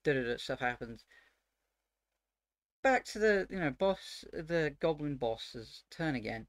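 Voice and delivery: ordinary talk, nothing marked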